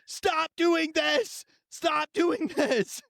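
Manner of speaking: high, slow mock shout; drawn-out high yelling plea; mock brain shouting. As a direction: yell like your own brain begging you to stop.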